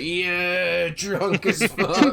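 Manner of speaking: put-on drunk Gertrude. Gertrude boasting to the table, slurring drunk. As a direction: play her sloshed and proud of it. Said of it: "And then Gertrude's like".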